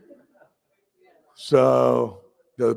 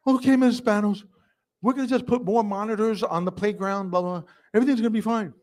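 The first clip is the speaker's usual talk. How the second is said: high, placating voice; nervous principal; 9 semitones higher, faster than usual. Retold or imitated. Principal caving to the mother, high and placating. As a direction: back down and soothe her quickly. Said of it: "She's like".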